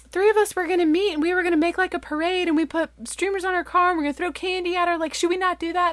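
high, hurried run of plans; concerned friends. Retold or imitated. Church friends asking mom about their parade, hurried and concerned.

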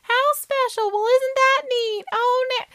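very high, cooing party guests; polite fawning crowd. Retold or imitated. Guests covering for an ugly ornament, high fake gushing. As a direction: gush with fake delight in falsetto. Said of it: "And then everyone's like".

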